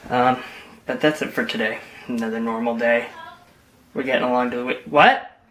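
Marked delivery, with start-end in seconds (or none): none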